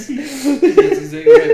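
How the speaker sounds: laughing loud and high; cracking up mid-sentence. Real, not acted.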